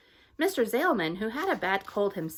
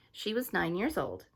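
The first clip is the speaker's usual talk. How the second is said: plain reading voice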